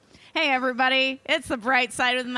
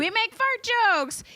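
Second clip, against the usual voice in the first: squeaky high mocking quote; high mocking imitation; 11 semitones higher than usual. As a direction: mimic him in a high voice. Mocking his claim.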